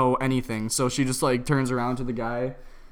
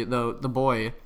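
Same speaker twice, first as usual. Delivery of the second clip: ordinary talk, nothing marked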